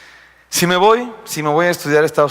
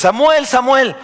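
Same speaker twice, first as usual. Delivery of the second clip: higher, louder call of God from afar; 10 semitones higher, 4 dB louder, slower than usual. Acted. Preacher voicing God calling young Samuel, calling out. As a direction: call out the name as if from another room.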